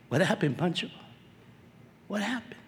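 soft, questioning voice of someone let down; gentle disappointment. Acted. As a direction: ask it quietly, letting it fade. Imagined Jesus, let down by him.

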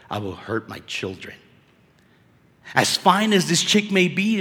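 normal talking voice

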